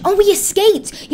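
high, loud, triumphant exclamation; excited cheer. Pretend. Player realises the escape has worked, excited cry. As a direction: burst out with delighted surprise. Prison escape succeeds in game.

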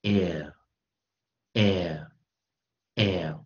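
"air" as a short e gliding into L, three times; short-vowel demonstration. Shown as a demonstration. Teacher demonstrating the short vowel ending air, spaced-out repetition. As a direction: sound out air slowly and separately for learners. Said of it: "念短元".

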